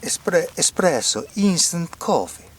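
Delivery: slow, high-pitched mock Italian guy; bad Italian accent. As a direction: draw it out in a hammed-up Italian accent. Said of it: "Rob Schneider doing an Italian guy".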